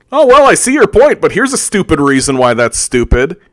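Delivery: high, nasal-free smug quoting voice, jumps high then settles; mocking a smug contrarian with a squeaky pedant voice; mock know-it-all. Ranting podcaster mocks a pedantic know-it-all, squeaky mock voice. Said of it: "We love being this guy".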